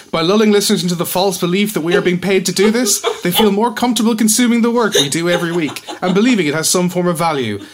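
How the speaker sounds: fast, pushy commercial read; ad announcer voice. Pretend. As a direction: rattle it off like an ad. Mock sponsor read.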